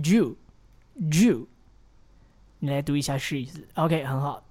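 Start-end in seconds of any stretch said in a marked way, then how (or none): 0.0-1.5 you said lightly, reduced; American reduced you. Shown as a demonstration. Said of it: "很多的老美啊，他会发成". (像老美一样弱化you)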